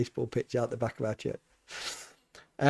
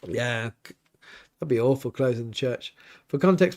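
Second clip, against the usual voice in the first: ordinary talk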